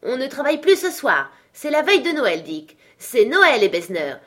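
loud, high festive orders; jovial shouting. A narrator's reading. Fezziwig closing shop on Christmas Eve, jovial shout. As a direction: call out merrily to the whole shop. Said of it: "cria le vieux Fezziwig".

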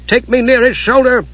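high-pitched urgent order; yelling. A narrator's reading. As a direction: call the command over the din of battle. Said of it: "Steele yelled to Flare".

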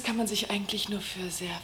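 whisper. Acted. Confides the price in secret.

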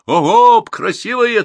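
high, loud herding call; urging on. A narrator's reading. Herder to his cattle, urging them on loudly. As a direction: hurry the animals along with a high shout. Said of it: "торопил он".

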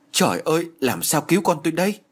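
high, despairing cry to the sky; crying to heaven. A narrator's reading. Mother lost in despair, high despairing cry. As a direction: wail helplessly to heaven. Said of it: "chỉ biết kêu trời".

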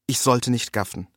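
usual speaking voice

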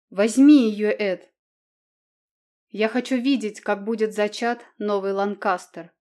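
domineering queen mother, loud and cruel; cruel goading. A narrator's reading. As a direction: whip him on like a tyrant mother. Queen goads son, raging.

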